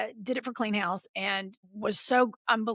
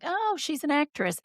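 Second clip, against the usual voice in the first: high, singsong imitated relative; lilting retold answer; mock explaining; 7 semitones higher than usual. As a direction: imitate grown-ups explaining to a child.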